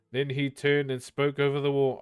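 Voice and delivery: usual speaking voice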